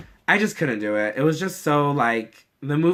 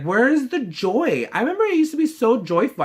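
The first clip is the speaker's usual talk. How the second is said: pleading; 9 semitones higher than usual. Retold or imitated. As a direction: stretch it out as a lament. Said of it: "And I was like".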